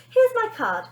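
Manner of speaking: high, loud chirpy TV nanny; bright high showbiz nanny; Wonder Nanny voice. Acted. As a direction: play the sugary celebrity nanny.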